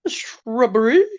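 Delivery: high, rising comic demand; hammed-up high "shrubbery" with a questioning lift; Knights of Ni. Quoting Monty Python to viewers, Knights of Ni voice. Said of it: "That was the Knights of Ni".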